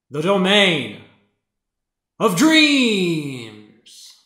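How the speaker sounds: booming, grand dark lord proclamation. Acted. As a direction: roar it like an evil overlord. Dramatic villain proclamation.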